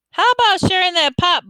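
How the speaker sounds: loud, high, eager request; high excited ask. Acted. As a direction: pitch it up high and bright. Lefty asks for pop.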